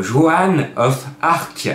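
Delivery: Joan of Arc prononcé lentement avec un mauvais accent anglais; un accent anglais un peu forcé sur Joan of Arc. Shown as a demonstration. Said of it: "avec mon mauvais accent anglais". (à l'anglaise, Joan of Arc, accent maladroit)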